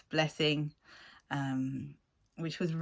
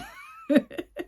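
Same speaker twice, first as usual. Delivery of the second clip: high burst of laughter; 4 dB louder than usual. Real, not acted. Laughing at own joke.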